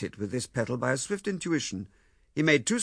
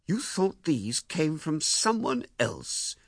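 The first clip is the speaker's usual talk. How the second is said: very slow, raised question of disbelief; 6 semitones higher, much slower than usual. A narrator's reading. Husband grasping the misunderstanding, slow stunned question. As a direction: spell it out slowly, stunned. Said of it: "he said".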